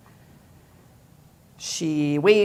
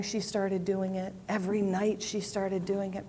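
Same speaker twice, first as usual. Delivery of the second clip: ordinary delivery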